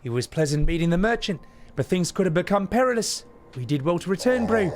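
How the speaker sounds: high-pitched, put-on character voice; chummy game character. Streamer reading merchant-meeting dialogue aloud, high chummy character voice.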